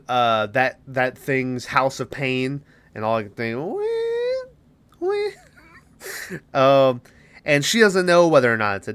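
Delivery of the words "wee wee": very high, thin squeal; tiny high-pitched noise, held long; imitated "wee". Spelt imitated noise in his head.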